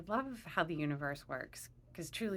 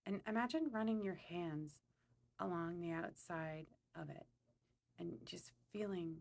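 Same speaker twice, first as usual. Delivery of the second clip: calm, unhurried meditation guide; guided meditation; 2 semitones lower, 6 dB quieter than usual. Acted. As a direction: lead them gently through a visualization, pausing between phrases.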